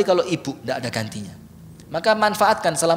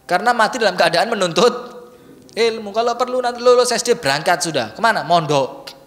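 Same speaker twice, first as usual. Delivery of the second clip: ordinary talk, nothing marked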